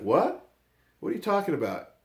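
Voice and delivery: high-pitched startled question; imitated disbelief. Coach hearing Brandon wants to come to practice, disbelieving. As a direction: retell it as a baffled coach. Coach reacts to surprising news.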